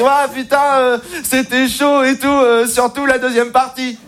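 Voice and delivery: high, drawn-out pupil's groan; mock schoolboy lament.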